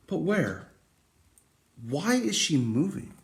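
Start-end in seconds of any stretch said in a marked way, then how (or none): none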